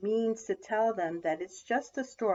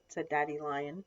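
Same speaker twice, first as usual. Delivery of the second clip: ordinary delivery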